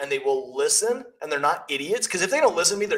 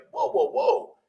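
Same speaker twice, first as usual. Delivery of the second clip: higher-pitched mock calming cry; retold interjection; 9 semitones higher, slower than usual. Agent's boss caught in the middle, imitated startled interjection. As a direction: act out stepping in to halt a squabble. Retold breaking up phone argument.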